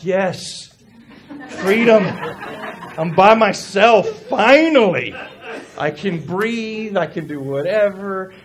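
loud, drawn-out exulting; hammed-up cheer of liberation; mock celebration. Pastor playing dad away from family, gleeful celebration. Acts out relief at being alone.

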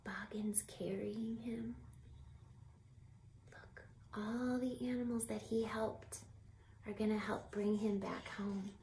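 whispered softly so he sleeps; hushed whisper. A narrator's reading. Teacher to children while Charles sleeps, hushed whisper. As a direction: whisper as if not to wake him. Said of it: "sleeping scene".

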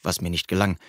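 ordinary delivery, nothing marked

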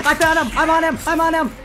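high, loud excited shouting; yelling across the room. Real, not acted. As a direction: shout it out to your teammate. Spotting enemy, calling to teammate.

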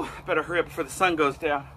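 ordinary talk, nothing marked

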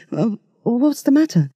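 lightly voiced, just woken; bleary. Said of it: "asked Duncan blearily".

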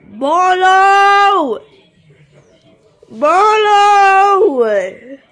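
loud, high calling. Pretend. Searching figure calling loudly for lost Marlo. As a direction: call across the room for Marlo.

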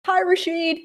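high, nervous hello; imitating her own nervous hello; nervous greeting. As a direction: squeak out a greeting to the boss. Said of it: "I'm like".

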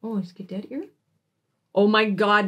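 usual speaking voice

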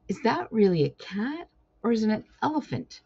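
high, disbelieving question; astonished. A narrator's reading. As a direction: marvel at the clumsy cat. Mistletoe astonished.

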